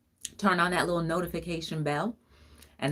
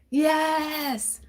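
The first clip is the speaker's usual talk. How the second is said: high yes; 11 semitones higher than usual. Real, not acted. Playful high affirmation.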